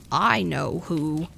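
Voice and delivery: slow, low, grudging grumble; grumbling. A narrator's reading. Lily grumbles to Edith about the girl, slow and low. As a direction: grumble it like you already know the trouble. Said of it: "Lily grumbled".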